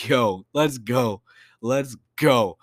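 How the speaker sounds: hyped, drawn-out cheer; loud stretched-out hype; excited. Retold or imitated. Hyped reaction to movie logo.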